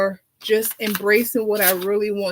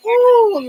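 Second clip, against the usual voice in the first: a diva's delighted high, loud ooh; thrilled diva squeal; 13 semitones higher, 7 dB louder than usual. Acted. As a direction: squeal it up high like you love it. Diva reacting to the song.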